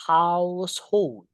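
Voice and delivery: Household drawn out slowly, the o said as a clear O; slow model word. Shown as a demonstration. Teacher modelling Household for students, slow and careful. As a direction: spell out Household slowly, HOUSE-hold with an open O. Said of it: "Ini O-nya dibaca O gitu".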